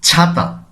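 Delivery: ছাতা said alone as "chhata"; correct ছ sound. Shown as a demonstration. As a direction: give ছাতা its aspirated chh at the start. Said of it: "কিভাবে বলছি".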